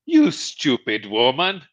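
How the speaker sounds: lightly hammed-up husband brushing off his wife; René's catchphrase. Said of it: "And they would go".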